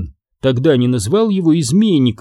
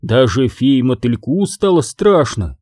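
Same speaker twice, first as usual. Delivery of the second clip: ordinary delivery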